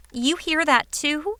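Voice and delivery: surprised, high question. Acted.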